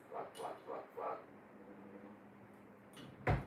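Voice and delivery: ordinary delivery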